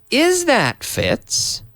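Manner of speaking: "Is that Fitz?" drawn out very slowly and high; slow question. Shown as a demonstration. Teacher modelling the question slowly for students. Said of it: "In the interrogative".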